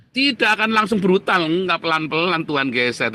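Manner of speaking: high, loud preacher's cry to the congregation; fiery preaching. Acted. Pastor crying out to the congregation, fiery preaching. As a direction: preach it out, ringing and forceful.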